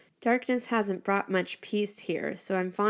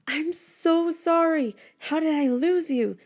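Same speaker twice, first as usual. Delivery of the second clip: higher, slow voiced apology; remorseful boy; 9 semitones higher than usual. Boy hugging his lost friend, slow higher remorseful apology. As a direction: plead sorry while holding her close.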